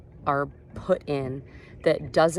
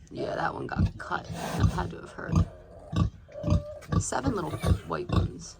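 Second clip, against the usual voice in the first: sympathetic whisper; hushed, wincing whisper; wildlife watching whisper; 7 semitones higher than usual. Real, not acted. Whispering about injured piglet.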